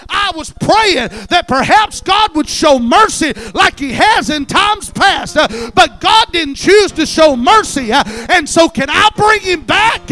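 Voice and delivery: loud, high-pitched shouted sermon; impassioned pulpit shouting, punched phrase by phrase; full-force preaching. Acted. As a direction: preach at full cry, driving every phrase.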